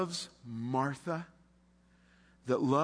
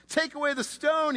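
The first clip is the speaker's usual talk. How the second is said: bold command; 12 semitones higher, 4 dB louder than usual. Acted. Jesus' command.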